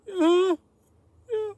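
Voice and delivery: loud, very high little reply; imitated startled high squeak; high-pitched yelp. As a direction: squeak back at the giant. Acts own reply to the giant.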